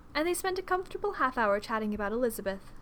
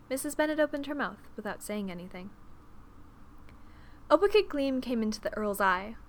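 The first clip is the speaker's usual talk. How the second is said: usual speaking voice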